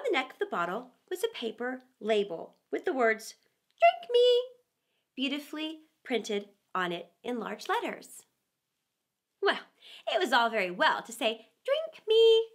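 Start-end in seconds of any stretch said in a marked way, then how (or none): 3.8-4.4 higher, louder announcing voice; label voice. A narrator's reading. Bottle label read out in a grand announcing voice. 11.6-12.5 higher, louder announcing voice; label voice. A narrator's reading. Bottle label read out in a grand announcing voice.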